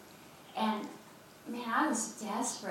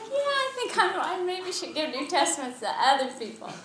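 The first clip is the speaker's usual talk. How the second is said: sheepish self-quote; 7 semitones higher, 6 dB louder than usual. Retold or imitated. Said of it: "so I was like".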